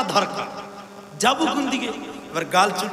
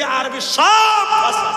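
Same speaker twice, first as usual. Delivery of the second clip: very high, loud and drawn-out wail; exasperated outcry; 12 semitones higher, 9 dB louder, much slower than usual. Real, not acted. Preacher crying out in exasperation to the crowd, high and loud. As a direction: stretch it out in comic despair.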